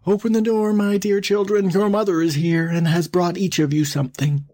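high, smoothed-out mock mother voice; softened wolf posing as mother. A narrator's reading. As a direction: coax sweetly high as the disguised wolf. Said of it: "to make his voice soft".

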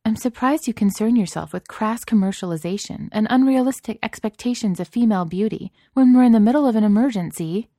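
pointed, indignant reproach; some reproof. A narrator's reading. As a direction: scold with lofty disapproval, swelling toward the end. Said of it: "Celia said with some reproof".

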